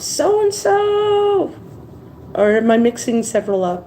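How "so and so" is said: mock scream. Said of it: "she'd scream out".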